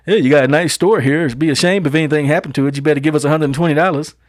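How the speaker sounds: ordinary delivery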